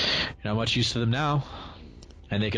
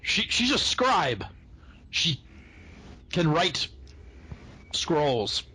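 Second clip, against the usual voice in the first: high, slow, timid gnome voice; nervous gnome voice; 8 semitones higher, slower than usual. Acted. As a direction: squeak it out like a frightened little gnome. Gnome pleads for the prisoner.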